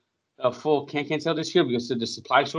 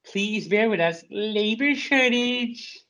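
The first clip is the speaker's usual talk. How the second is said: high, slow sign-reading voice; 7 semitones higher, slower than usual. Pretend. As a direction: drawl the notice out in a silly high voice. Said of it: "they have the sign out there".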